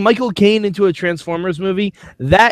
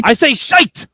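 higher-pitched mock English accent; 8 semitones higher, 3 dB louder than usual. Co-host defending his slang, higher mock English accent. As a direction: insist in a put-on English accent. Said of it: "in that accent now".

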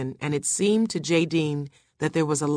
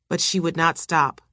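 plain reading voice

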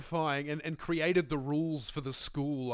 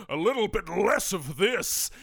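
jester voice; 4 semitones higher, 5 dB louder than usual. Shown as a demonstration. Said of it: "a little bit more subtle".

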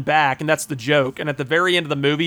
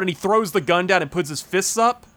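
ordinary talk, nothing marked

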